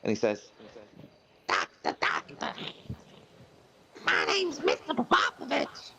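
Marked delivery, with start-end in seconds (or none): 1.5-5.9 very high, slow moth character voice. Acted. Moth telling the doctor his name, very high and slow. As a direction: squeak it out like a sad little moth.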